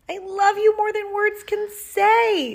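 very high, loud adoring declaration; gushing aunt. A narrator's reading. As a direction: pour out love to a nephew, high and loud. Aunt gushing love in story.

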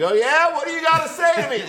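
hammed-up tough-guy yell; mock loud challenge. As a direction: shout it across the field to the concession stand. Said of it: "Like, loud enough".

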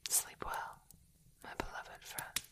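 soft whisper fading toward silence; ASMR whisper. Acted. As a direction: lull a friend to sleep in a whisper. Comforting a friend to sleep.

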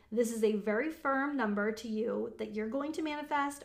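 ordinary talk, nothing marked

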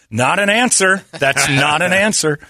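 mock-indignant pushback at the nurse; mock exasperation. Protesting nurse's laugh-off.

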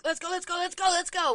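high, urgent chant on repeat; anxious hurrying. Retold or imitated. Mom hurrying her child from the car, anxious urging. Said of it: "which is taking over my, like, anxiety".

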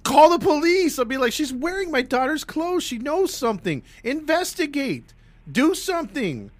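high, loud, insistent demands; exasperated shout. Pretend. Imagines own reaction loudly.

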